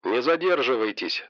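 quiet, distant headset voice; like over a radio headset; through headphones. Acted. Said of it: "в наушниках голос учёного".

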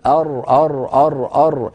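"أر، أر، أر، أر" as a repeated rolled-r exercise; ra practice drill. Shown as a demonstration. Teacher telling a student how to train at home, "أر" repeated as a drill. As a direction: drill "أر" evenly, tongue tip sounding each ر. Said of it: "تدرب، قول".

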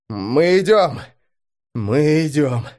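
ordinary delivery

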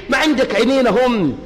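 ordinary talk

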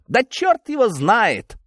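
high, drawn-out outburst; slow, emphatic high exclamation; exasperated exclamation. A narrator's reading. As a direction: exclaim it as if shaken up, pitch leaping. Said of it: "воскликнул он".

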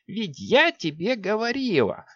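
high, slow strained polite witch; forced courtesy from a sorceress; strained politeness. A narrator's reading. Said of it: "с натянутой вежливостью".

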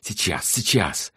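pleading reassurance, stretched out. A narrator's reading. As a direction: stall someone with a drawn-out plea. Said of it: "умоляющим голосом попросил".

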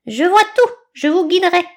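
high, loud grandiose eagle voice; pompous eagle orating. A narrator's reading. Eagle lecturing the group, high and pompous. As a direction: hold forth grandly.

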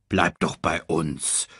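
low, slow, gentle invitation; friendly warthog. Acted. Warthog inviting the cub to stay, low kindly voice. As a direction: offer it slowly in a low voice.

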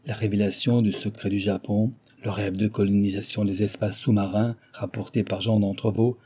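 ordinary delivery, nothing marked

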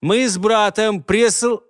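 slow high words catching; words stick in the throat; struggling to speak. Acted. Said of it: "повисла напряжённая тишина".

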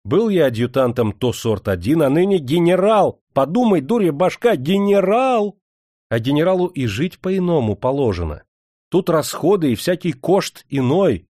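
loud, emphatic, swelling on the new rank; pompous boasting. A narrator's reading. Master justifying his expenses to steward, pompous boasting. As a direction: hammer home how grand a general is.

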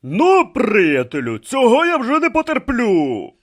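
loud, high indignant threat; irate high shout; fed-up outburst. Acted. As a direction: burst out as if you've had enough. Fighter loses patience with rival.

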